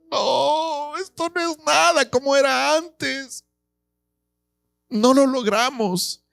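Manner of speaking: high, soft, slow grieving wail; old men weeping over the lesser new temple; mournful lament. Acted. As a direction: mourn what was lost, voice falling away. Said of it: "se ponen a llorar".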